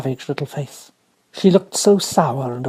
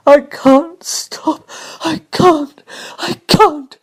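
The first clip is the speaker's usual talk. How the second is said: put-on high, loud, slow wailing boy; sobbing despair; 11 semitones higher, 5 dB louder, much slower than usual. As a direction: cry out between sobs.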